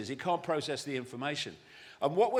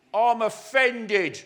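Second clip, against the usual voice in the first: louder, higher, slow indignation; mock offended; 5 semitones higher, 7 dB louder, much slower than usual. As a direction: draw out the grievance, imitating the easily offended. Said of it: "people say".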